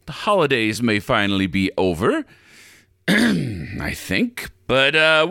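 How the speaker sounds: normal talking voice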